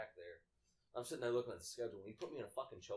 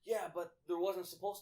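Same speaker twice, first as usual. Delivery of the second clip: higher, louder mock protest; mock screaming customer; 8 semitones higher, 7 dB louder than usual. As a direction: argue back as the irate customer. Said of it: "she's sitting there screaming".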